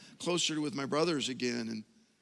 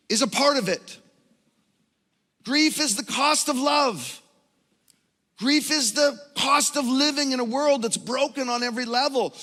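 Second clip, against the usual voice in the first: full-force preaching; 8 semitones higher, 7 dB louder than usual. Acted. Pastor preaching to the church on grief, at full force. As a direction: drive each line home to the congregation.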